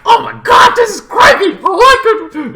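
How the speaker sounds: a high-pitched, loud, delighted squeal; excited squeal. Retold or imitated. Reliving first watching the creepy scene, squealing with delight. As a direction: gush at how creepy it is. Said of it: "but this was like".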